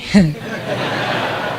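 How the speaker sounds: breathy unvoiced chuckle; a quiet laugh at the story; soft laugh. Real, not acted. Laughing softly to the audience. Preacher laughs at own retelling.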